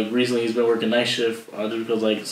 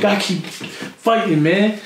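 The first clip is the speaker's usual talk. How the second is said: mock pep talk; 7 semitones higher, much slower than usual. Quoting Rocky-style pep talk.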